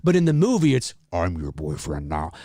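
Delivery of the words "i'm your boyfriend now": low, pitched-down Freddy; lowered pitch. Retold or imitated. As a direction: say it deep like a processed voice. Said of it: "they've clearly lowered the pitch".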